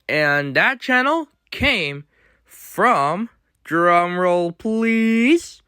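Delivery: ordinary talk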